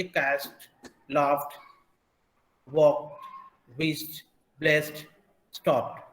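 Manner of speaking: कैच्ड through स्टॉप्ड each ending in a voiceless "t"; spaced word list from कैच्ड to स्टॉप्ड, t at each end. Shown as a demonstration. Teacher recapping the rule, कैच्ड to स्टॉप्ड said with final t. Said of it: "ट की तरह करते हैं".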